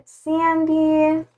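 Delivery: ordinary delivery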